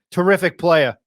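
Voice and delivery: hammed-up imitation of the announcer; imitating the announcer. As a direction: echo the broadcaster's praise with a grin. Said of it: "I love Pete Gillen".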